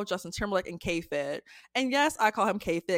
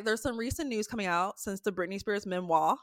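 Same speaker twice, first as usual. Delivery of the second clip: ordinary talk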